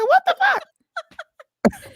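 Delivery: replaying own high shocked yelp; voice up an octave. Retelling own shock at the fake flash, high mock shriek. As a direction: stretch it out sky-high and loud, mimicking your shock. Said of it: "My voice went up a whole octave".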